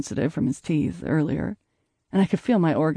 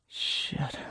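breathless whispered curse; murmured; 10 dB quieter than usual. Acted. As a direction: whisper it, barely audible. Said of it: "he murmured".

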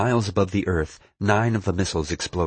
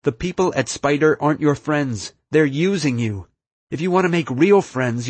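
higher, emphatic appeal, building; urgent plea; 6 semitones higher, 4 dB louder than usual. A narrator's reading. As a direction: plead earnestly to win them over. Narrator's hero pleads with villain.

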